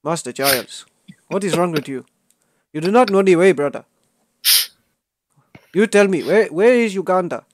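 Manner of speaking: put-on animated Ugandan meme accent; mock Ugandan accent. Confronting a player who lacks the way, mock Ugandan accent. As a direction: challenge them in a hammed-up Ugandan accent.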